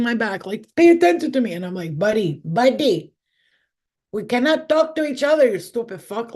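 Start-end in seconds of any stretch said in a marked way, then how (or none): 0.8-1.5 high, loud pestering demand; demanding spirit. Retold or imitated. Spirit tugging at the medium's hand, imitated demanding yell. 2.0-6.3 exasperated. Retold or imitated. Acted exasperated retort to spirit.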